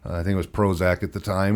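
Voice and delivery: ordinary talk, nothing marked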